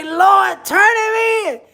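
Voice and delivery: high, loud imitation of a crying aunt; mock wailing plea. Host replays the aunt's crying plea on the news. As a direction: mimic her wail again. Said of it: "why did the auntie say".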